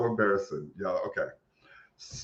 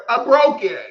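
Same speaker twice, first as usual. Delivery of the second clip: higher and louder, frantic; panicked wail; 10 semitones higher, 7 dB louder, slower than usual. Retold or imitated. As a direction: cry it out in panic. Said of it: "I was like".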